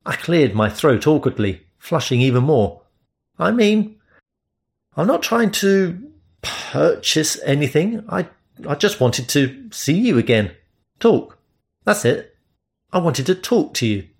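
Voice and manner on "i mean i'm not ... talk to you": flustered, insisting on innocence; lightly voiced nervous Englishman; embarrassed explanation. Narrator embarrassed explaining himself.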